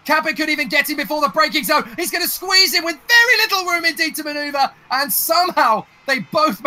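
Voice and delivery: rising, building play-by-play; excited race call. Real, not acted. Commentator calls Capet's overtake live.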